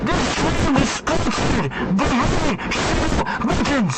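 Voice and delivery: crunchy, distorted cheap-mic sound; shitty mic. Shown as a demonstration. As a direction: read it through a terrible mic. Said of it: "This, this terrible, terrible, no good, very bad mic".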